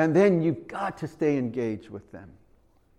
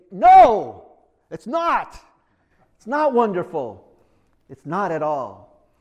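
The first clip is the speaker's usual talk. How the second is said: emphatic no; 4 semitones higher, 10 dB louder than usual. Real, not acted. Preacher rejects what he just quoted.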